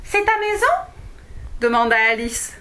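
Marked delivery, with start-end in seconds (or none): 0.0-0.9 high, louder wondering question; amazed girl. Acted. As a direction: ask in awe, pitched up. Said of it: "d'une voix éliumée".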